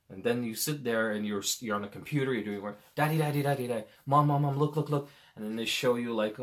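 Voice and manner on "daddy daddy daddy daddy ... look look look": put-on loud insistent kid, repeating; pestering child. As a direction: pester the busy parent, rising. Kids interrupting parent at work.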